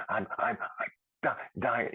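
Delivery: mild mock gasping, words broken up by lost breath. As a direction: push each word out as if the air is gone. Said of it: "when I talk, I'd be like".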